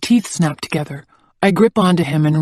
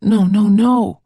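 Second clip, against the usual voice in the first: pleading; 4 semitones higher, 3 dB louder, slower than usual. A narrator's reading. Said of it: "I beg and plead and say".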